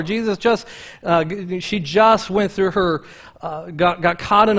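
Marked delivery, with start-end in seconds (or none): none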